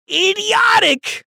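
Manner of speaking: "idiotic" high, loud and slow; drawn-out scornful "idiotic"; punched word. Real, not acted. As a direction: spit out "idiotic" with contempt. Ranting at critics.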